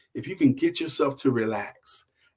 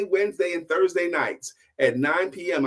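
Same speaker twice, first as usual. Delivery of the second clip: ordinary delivery, nothing marked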